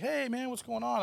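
high-pitched friendly hail; retold casual greeting. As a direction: greet an acquaintance in passing, voice lifted. Acts out director greeting him.

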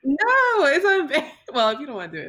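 high laughing protest. Real, not acted. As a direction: laugh while reassuring. Laughing at friend.

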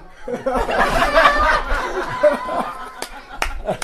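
loud laugh. Acted. Guru bursts into laughter.

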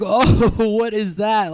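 mocking laugh-talk, high and slow; imitating mom laughing. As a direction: retell mom laughing at you. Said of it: "she's laughing at me".

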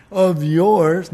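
Of yours punched loud; loud contemptuous of yours; stress on of yours. Acted. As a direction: disown the brother with it. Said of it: "not acknowledging that this is his brother".

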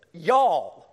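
the Southern plural y'all, emphasized; stressed y'all. Shown as a demonstration. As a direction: lean hard on y'all. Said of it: "It's plural".

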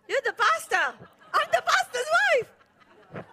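loud, laughing call to the husband. Real, not acted. Wife calling her husband up to the stage, loud and laughing. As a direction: laugh it out to the room.

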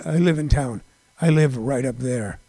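low, soft impression of a singer. Retelling the singer at a Rite Aid window, low impression. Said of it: "Try to do my best [name], uh, voice".

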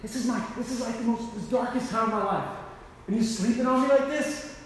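can't believe they're sleeping now; exasperated disbelief. Retold or imitated. Jesus to his sleeping friends, high exasperated disbelief.

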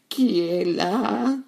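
put-on high, drawn-out old granny voice; high granny voice asking who is there; grandmother. A narrator's reading. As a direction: call out slowly and high, like a frail grandmother. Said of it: "demanda la grand-mère".

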